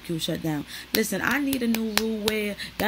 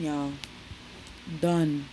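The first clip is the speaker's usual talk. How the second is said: usual speaking voice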